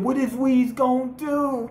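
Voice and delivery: high-pitched mock fretting; nail-biting worry. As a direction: retell it as a nervous nail-biter. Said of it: "he got the biting his nails".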